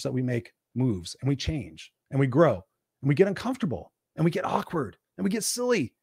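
ordinary delivery

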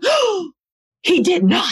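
mock outraged gasp, high on Oh; playfully scandalised Oh, he did not; sassy retold outrage; indignant disbelief.

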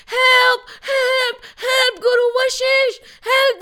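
high, panicked cries for help. Acted. Drowning villagers calling Guru Vashisht, high panicked cries. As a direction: shriek for the guru to save them. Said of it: "they started shouting".

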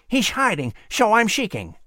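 whistling squirrel voice. Acted.

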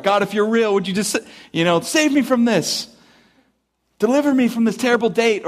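mock last-ditch prayer; Hail Mary prayer. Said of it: "a Hail Mary prayer".

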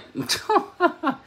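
sudden squeaky burst of laughter; high laugh. Real, not acted. Laughing at the YouTube pronunciation, high laugh. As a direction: let out a high amused laugh.

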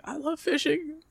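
high, soft put-on fan voice; hammed-up, high-pitched gushing; mock eager fan. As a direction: butt in with pretend starstruck eagerness. Imagined fan butting into celebrities' conversation.

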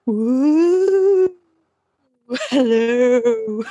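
eerie drawn-out ghostly woo; haunt them with a playful ghost call; spooky voice. Pretend. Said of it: "That's my spooky voice".

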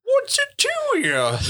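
put-on high, slow old codger voice; gruff mountain man. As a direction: squeak it out like an old prospector. Repeating the old man's line.

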